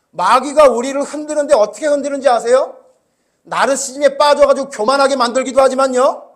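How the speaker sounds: loud, driving sermon delivery; preaching at full force. Acted. Pastor warning the congregation about the devil, loud preaching. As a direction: hammer the warning home to the flock.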